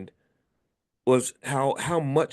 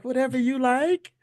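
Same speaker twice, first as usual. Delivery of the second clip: high, eager-to-please servant voice; imitating the Coming to America lady; 11 semitones higher than usual. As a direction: coo it up high, fully submissive. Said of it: "like that lady in Coming to America".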